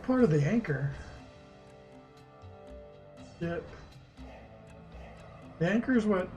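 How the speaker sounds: ordinary talk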